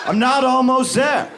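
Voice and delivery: mock yell. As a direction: yell it back at the cheering crowd. Runner yells back.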